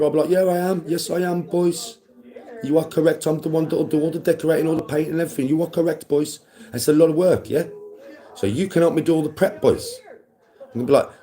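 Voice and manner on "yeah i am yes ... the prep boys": put-on high-pitched, emphatic agreeing with the boys; hammed-up dad laying down the law, pitched high; mock insistent dad. Dad roping his boys into the prep, mock insistent. Acts out his reply to sons.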